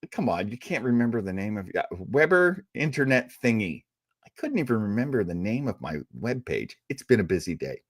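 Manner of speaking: ordinary talk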